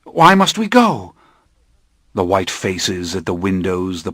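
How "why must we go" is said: put-on high, scared young voice; a boy pleading in fear; frightened child. Frightened child Armand asks.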